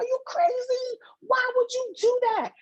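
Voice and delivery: high, shocked friend voice; bestie's voice. Retold or imitated. Bestie scolding her over the laptop, high shocked voice. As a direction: squeal it in disbelief. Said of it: "She's like".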